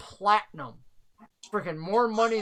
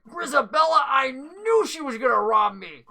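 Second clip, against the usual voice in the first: indignant; 5 semitones higher, 4 dB louder than usual. Acted. Robbed man naming the thief.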